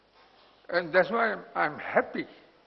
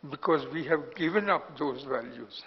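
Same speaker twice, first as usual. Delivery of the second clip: ordinary delivery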